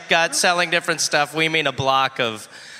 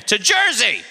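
rallying cry; 6 semitones higher than usual. Pretend. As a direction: shout it like a battle cry. Rallying call to move.

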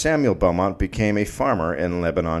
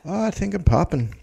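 mock French accent; 10 semitones higher than usual. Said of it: "that'd be in a French accent".

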